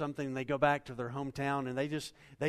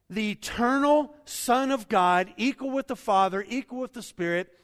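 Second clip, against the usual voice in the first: full-force preaching; 5 semitones higher, 7 dB louder than usual. Acted. Preaching climax.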